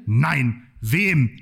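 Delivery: normal talking voice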